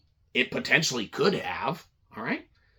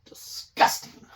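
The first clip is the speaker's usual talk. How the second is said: whispered disgust; slower than usual. Real, not acted. Gross milk.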